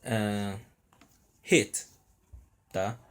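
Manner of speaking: ordinary talk, nothing marked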